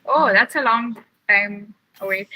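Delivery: ordinary delivery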